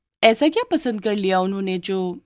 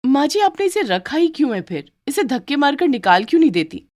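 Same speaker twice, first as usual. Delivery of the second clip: scornful bride; 8 semitones higher than usual. Acted. Bride demands maid thrown out.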